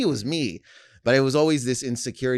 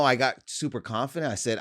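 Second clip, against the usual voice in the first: ordinary talk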